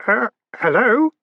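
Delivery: high, slow hesitant call; nervous call. A narrator's reading. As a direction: call timidly into the dark. Calling into a dark hall.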